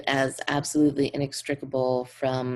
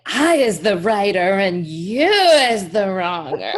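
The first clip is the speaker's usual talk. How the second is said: laughing, goofy taunting voice; sing-song silly mockery; silly mocking voice; 6 semitones higher, 10 dB louder than usual. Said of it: "And he'll be like".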